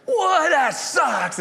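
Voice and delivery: very high, loud indignant yell; outraged fan cry. Retold or imitated. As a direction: shriek it like a betrayed fan. Duck fans protesting.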